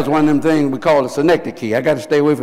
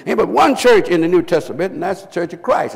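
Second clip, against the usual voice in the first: usual speaking voice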